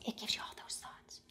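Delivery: hushed whisper; confidential, barely audible whisper. Shown as a demonstration. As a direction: let it drop to a secretive whisper. Demonstrating the whispering thinking voice.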